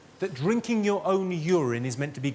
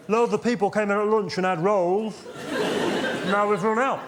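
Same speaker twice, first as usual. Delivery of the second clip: higher, sing-song shop-counter voice; bakery assistant voice; 3 semitones higher than usual. Retold or imitated. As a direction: explain it like there's nothing to be done. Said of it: "in the same voice".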